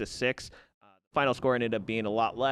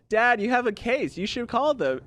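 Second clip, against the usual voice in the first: put-on high, louder little girl voice; lightly voiced eager daughter; Lisa voice; 10 semitones higher, 3 dB louder than usual. Retelling Lisa urging her dad, high girlish voice. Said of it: "Lisa's like".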